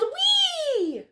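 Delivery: very high, gliding whee; a kid whooping with joy on his bike; gleeful whee. Acted. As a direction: let it fly like riding downhill. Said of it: "He yelled".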